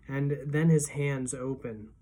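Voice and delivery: usual speaking voice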